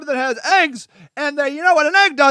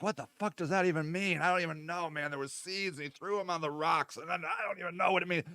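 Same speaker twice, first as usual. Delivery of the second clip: hushed, confused muttering among themselves; muttered under the breath, lost; baffled disciples; 8 semitones lower, 15 dB quieter, faster than usual. Retold or imitated. Disciples puzzling over Jesus' parable, hushed and baffled. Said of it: "and they're like".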